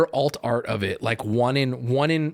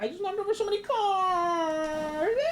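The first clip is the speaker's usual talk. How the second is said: very high mock-crying British lady; slow hammed-up wail, stretching the last word; crazy British lady; 4 dB quieter, slower than usual. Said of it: "British lady who's like".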